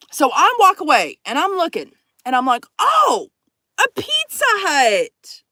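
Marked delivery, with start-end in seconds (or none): 2.8-5.4 high, drawn-out gasp of delight; thrilled, stretching the words out high; excited discovery. Retold or imitated. Storyteller spots a Pizza Hut, overjoyed.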